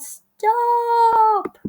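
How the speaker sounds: high, loud, drawn-out yell; exasperated parents' shout. A narrator's reading. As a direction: yell it long and high at a child. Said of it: "but her parents yelled".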